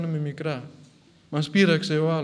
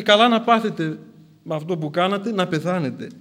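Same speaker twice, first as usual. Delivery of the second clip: louder, raised mock curse; acted vindictive outburst; 3 semitones higher, 6 dB louder than usual. What the saint could have said to his persecutors, vindictive. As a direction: throw it at them as if they deserved it.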